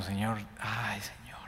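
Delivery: breathy unvoiced plea to God; imitating a whispered cry to the Lord; whispered prayer. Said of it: "estoy diciendo".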